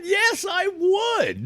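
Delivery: loud, high, slow emphatic yes. Retold or imitated. Retelling his answer to Amazon's T-shirt offer, a loud drawn-out yes. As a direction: belt out the yes like a proud convert.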